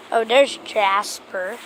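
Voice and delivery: usual speaking voice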